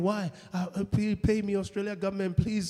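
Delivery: ordinary talk, nothing marked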